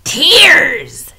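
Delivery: high, loud gleeful shout; loud mocking rat chorus. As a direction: jeer it out hungrily. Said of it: "shouted the rats".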